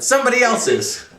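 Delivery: very high, loud outburst; colonel's shout. A narrator's reading. Colonel correcting his corporal, shouting. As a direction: yell it in exasperation. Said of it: "the colonel shouted".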